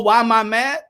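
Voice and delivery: ordinary talk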